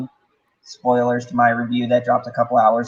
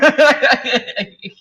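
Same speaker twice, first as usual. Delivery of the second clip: laugh; 11 semitones higher, 4 dB louder than usual. Real, not acted. Laughing after own joke.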